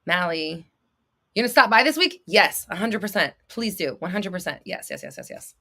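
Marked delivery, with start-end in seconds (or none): 4.6-5.5 1 muttered yeses. Real, not acted. Quick trailing repeated agreement.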